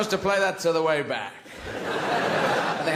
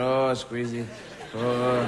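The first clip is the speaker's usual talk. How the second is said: lower, cooing chef testing fruit, laughing; mock French chef; 6 semitones lower, much slower than usual. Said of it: "French chefs do on television".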